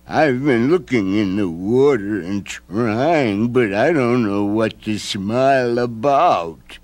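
slow, odd gloomy creature; mope voice. A narrator's reading. Mope asking Nancy to smile again, slow. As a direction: drag it out like a sad creature.

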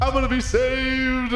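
high, drawn-out cry of relief; elated relief. Pretend. Captive as the cops arrive, high elated wail. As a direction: stretch it out high, overjoyed at rescue.

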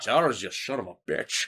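low Scottish Sean Connery with a laugh; a Scottish Magneto insulting Charles; mock Sean Connery Scottish accent. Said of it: "what if Sean Connery was Magneto".

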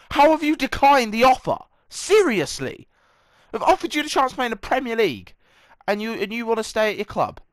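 loud, high, exasperated outburst; indignant shouting at a stubborn player; angry rant. Real, not acted. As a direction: tear into the player who turned you down. Ranting at player who declined.

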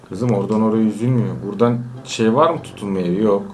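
ordinary delivery

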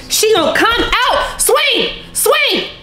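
high-pitched cries to swing; urgent egging on. Real, not acted. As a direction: cheer her on to strike. Urging film girl to swing.

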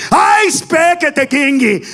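high, drawn-out mocking shriek; exaggerated high-pitched holler. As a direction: yell it slow and high, imitating her broken English. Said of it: "this bitch went like this".

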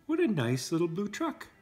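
usual speaking voice

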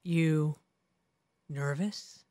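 deep mock voice. S2 mimics Dan teasing Rich, low quiet voice. S2 imitating Dan's line.

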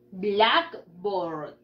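"Blackboard" in two drawn-out parts, A of board silent; practice model. Shown as a demonstration. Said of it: "we are going to practice". ("Blackboard" para practicar, sin la A de board)

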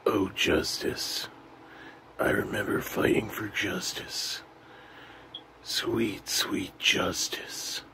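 slow, soft hushed reverie; hammed-up melancholy whisper; mock wistful whisper.

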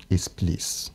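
ordinary talk

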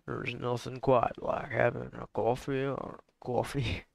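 slow, lightly put-on Southern then New York drawl; mixed accents. Streamer mixing Southern and New York accents, lightly. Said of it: "two completely different bloody linguistical accents".

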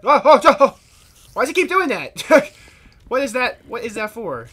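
loud high surprised yelps; startled outcry. Real, not acted. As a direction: yelp in sudden surprise. Startled by a mob.

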